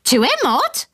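high parrot squawk with a rising end. Retold or imitated. Narrator repeats the parrot's squawk, high and rising. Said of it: "it had squawked".

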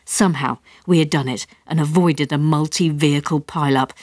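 ordinary delivery, nothing marked

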